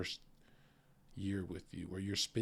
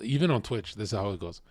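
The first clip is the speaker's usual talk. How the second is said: ordinary talk, nothing marked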